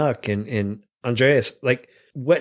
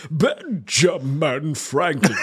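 slow grand Franklin; much slower than usual. Acted. Trying out Franklin voices.

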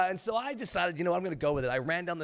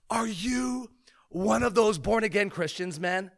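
slow, higher-pitched mock confrontation; aggressive challenge; 5 semitones higher, 3 dB louder, slower than usual. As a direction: throw it at him as a drawn-out accusation. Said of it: "He gets super aggressive".

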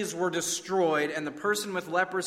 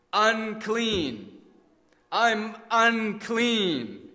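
slow, anguished cry of unclean, pitched higher; choked out; 6 semitones higher, 4 dB louder, much slower than usual. Acted. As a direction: force the words out in anguish. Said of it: "he choked out the dreaded words".

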